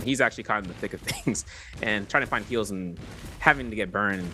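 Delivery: usual speaking voice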